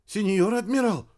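high-pitched frightened plea; desperately afraid. A narrator's reading. Radio operator ordered to the bridge, afraid. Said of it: "радист отчаянно боится".

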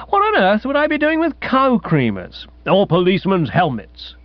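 high-pitched, airy incredulity; scoffing protest. Acted. Guest denying he took cow creamers, airily incredulous.

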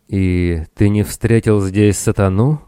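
plain reading voice

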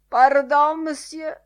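high, loud French apology; startled apology. A narrator's reading. Lenihan bumping into Bloom, startled apology. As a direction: exclaim it on impact.

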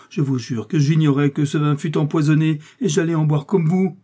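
high, loud sworn protest; fervent plea to a dying man; urgent cry. A narrator's reading. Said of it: "s'écria D'Artagnan en se précipitant".

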